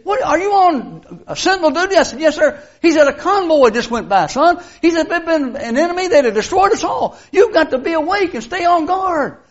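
lieutenant lecturing the guard who fell asleep; scolding lieutenant. Retold or imitated. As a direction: dress down the private.

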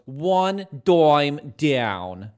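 slow, loud, drawn-out Bronx Italian pitch; fake Italian Bronx accent. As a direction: stretch each word like a cheesy Bronx car ad. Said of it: "really bad fake, like, Italian Bronx accent".

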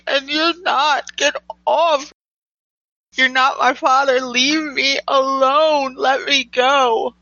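high, distraught protest building in force; upset outburst. Acted. Girl fights off the man holding her, upset and building to yelling.

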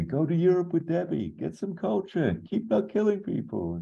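high, mock-breezy advice; Arkin impression. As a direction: toss off the advice in a lifted, teasing voice. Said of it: "Arkin's just simply".

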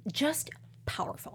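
breathless whispered awe; retelling being overwhelmed, whispered. Retold or imitated. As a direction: whisper it as if overcome. Quoting her moved inner voice.

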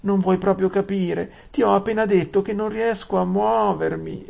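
put-on very high childish sobbing complaint; tearful whine. Saralin whines tearfully at Aravis, very high. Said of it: "si lamentò la Saralin fra le lacrime".

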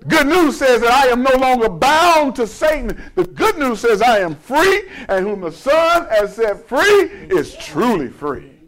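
high, driving pulpit proclamation; forceful preaching. Real, not acted. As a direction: ring out each point of good news.

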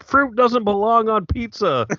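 high, loud mocking voice of a pizza purist; mock indignant quote. Mocking pineapple haters to his cohost, high and loud.

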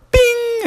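high sung ding; bright elevator chime sound; elevator bing. Acted. Elevator arrives.

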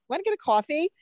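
put-on high-pitched Jersey girl accent. Woman imitating her friend asking for coffee, Jersey accent. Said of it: "the accent comes out most when she says coffee".

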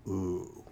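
long pause, then a low, quiet ooh; dramatic pause. Acted. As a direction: hold the silence, then breathe ooh. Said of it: "dramatic pause".

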